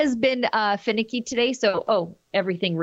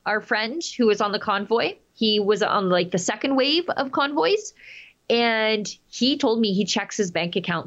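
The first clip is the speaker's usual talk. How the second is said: usual speaking voice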